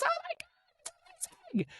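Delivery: mock excited scream in a tiny falsetto; Renee. Redhead hears he's moving to Woodstock, imitated excited squeal. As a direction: squeal very high but softly, imitating her excitement. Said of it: "she screamed with excitement".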